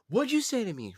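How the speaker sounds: high, loud indignant snap; mock angry sister. Sister snapping at him, high loud challenge. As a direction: fire back with fake outrage. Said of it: "she's like".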